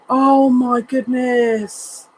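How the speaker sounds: really choked up, slow and high; overwhelmed, near tears. Real, not acted. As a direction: let it stretch out, moved almost to tears. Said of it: "I'm gonna start crying".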